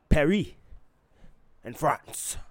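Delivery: hammed-up attempt at a French accent; clumsy mock-French accent; bad French accent. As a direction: say the place names in a badly faked French accent. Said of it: "French accent I could ever come".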